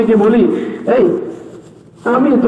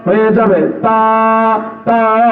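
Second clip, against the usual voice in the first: হয়ে যাবে তা said very slowly, তা drawn out; slow drawn-out teaching; much slower than usual. Shown as a demonstration. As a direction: stretch the তা like a teacher. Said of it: "লাঠির উপরে দুই নোকতা দাও". (ধীরে টেনে তা)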